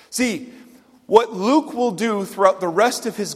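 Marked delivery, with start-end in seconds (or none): none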